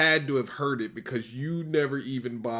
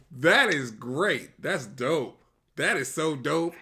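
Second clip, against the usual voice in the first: ordinary talk, nothing marked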